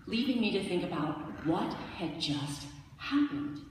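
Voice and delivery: usual speaking voice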